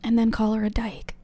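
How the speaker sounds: a voiceless whispered aside. Real, not acted. Prompting a friend on the side, whispered.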